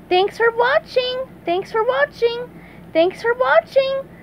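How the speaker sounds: high, bright cartoon sponge; high-pitched eager SpongeBob. SpongeBob greets cheering crowd.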